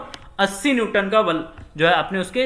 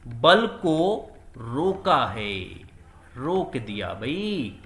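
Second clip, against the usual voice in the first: slow emphasis; 5 semitones lower, much slower than usual. Shown as a demonstration. Teacher stressing key point.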